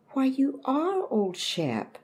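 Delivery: high, slow, amazed cry of recognition; surprised exclaim. A narrator's reading. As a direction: sound delighted to recognise the old dog. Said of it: "Mama exclaimed".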